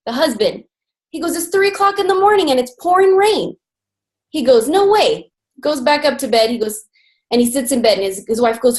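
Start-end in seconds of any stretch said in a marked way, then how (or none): 1.4-3.6 high-pitched, quick mock protest; acted indignant complaint; exasperated husband. Husband protests to stranger at door. 4.7-5.3 high-pitched mock refusal; emphatic, pitched-up brush-off; indignant refusal. Husband refuses the stranger.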